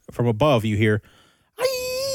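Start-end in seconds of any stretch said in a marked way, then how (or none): 1.6-2.2 very high shrieking cry; monster cry. Acted. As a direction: shriek like a creature attacking.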